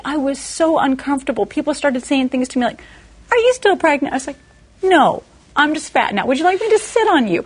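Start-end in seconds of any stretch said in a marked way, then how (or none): 3.3-4.1 mock nosy question. Mocking people's question. 4.8-7.4 sarcastic retort. Retold or imitated.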